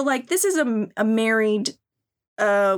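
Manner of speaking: ordinary talk, nothing marked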